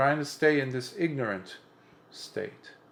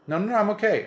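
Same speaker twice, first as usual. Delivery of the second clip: high, louder mock protest; dismissive insistence; 10 semitones higher, 6 dB louder, much faster than usual. Therapist insisting they need no more work, mock dismissive. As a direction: brush off concern with hammed-up insistence. Quoting therapists brushing off concern.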